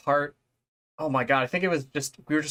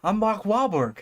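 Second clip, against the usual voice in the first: lightly voiced dopey Texas scientist, pitched up; Mark Wahlberg impression; 6 semitones higher than usual. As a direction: play him as a clueless movie star. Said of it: "He's like".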